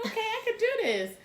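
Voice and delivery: hammed-up self encouragement; self pep talk. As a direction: cheer yourself on in a squeaky high voice. Psyching herself up.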